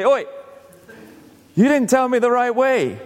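mock angry stranger. Lost stranger scolding the direction giver, mock indignant. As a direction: complain you were sent the wrong way.

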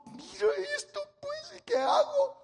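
high-pitched, tearful complaint to God; whining plea. Retold or imitated. Preacher retelling his own complaint to God, high tearful whine. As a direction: sound on the edge of crying. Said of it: "Y le digo".

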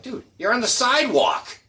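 very high, loud, incredulous retort; exasperated protest. Retold or imitated. Restaurant worker to badly parked biker, exasperated high protest. Retold argument with motorcycle owner.

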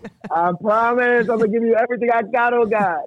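high-pitched begging to God; playful re-enactment of a frantic promise; mock desperate pleading. Joins in mock bargain with God.

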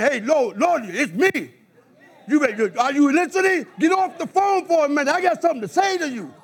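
barge in and demand God's attention; bold prayer. Pretend. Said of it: "I'm not coming like no wimp".

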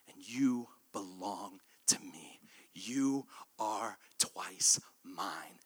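low, soft whisper; boy whispering lovingly to the boat he won back; tender whisper. Acted. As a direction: murmur it like a secret love. Boy tenderly speaks to his boat.

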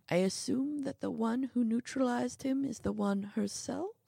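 put-on a touch of hope in an old man's voice; hopeful old man. A narrator's reading. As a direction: ask it as if hoping for a yes. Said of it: "The old man's voice was hopeful".

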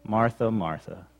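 low, soft, gentle reproof; gently critical. Acted. Jesus gently correcting Martha by saying her name twice, gently critical. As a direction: chide gently, voice dropped low. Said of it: "gently critical".

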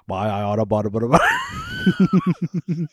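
slow, sped-up cartoon villain voice, laughing; Bugs Bunny villain. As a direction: splutter it like an angry cartoon baddie. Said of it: "he sounds like the villain from Bugs Bunny".

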